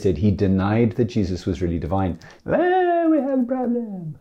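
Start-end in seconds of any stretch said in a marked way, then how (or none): 2.4-4.2 held high dramatic note; hammed-up high warning. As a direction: sing it out high like a melodrama. Sung-out dramatic line.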